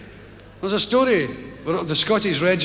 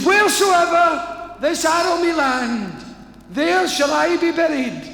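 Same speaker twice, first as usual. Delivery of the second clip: high, slow, hammed-up heroic shout; grand proclamation; 12 semitones higher, 3 dB louder, slower than usual. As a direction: declaim it like a dying hero. Dying Robin's grand final declaration.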